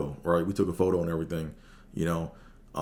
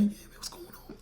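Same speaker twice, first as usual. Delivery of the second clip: soft unvoiced whisper of what's going on; hushed greeting; 9 dB quieter than usual. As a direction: lean in and whisper it. Greeting famous comedian.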